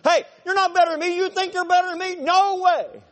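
high, loud squealing comeback; hammed-up indignant runner yelling back; whiny retort. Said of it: "And someone goes".

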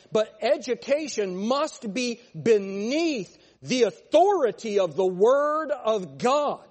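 loud, rising fervent sermon; preaching at full force. Real, not acted. Preacher hammering his congregation about education, full-force preaching. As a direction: drive the point home to the pews.

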